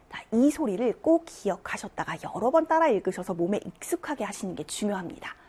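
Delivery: ordinary talk, nothing marked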